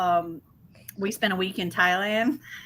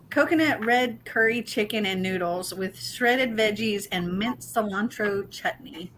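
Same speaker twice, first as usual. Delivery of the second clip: usual speaking voice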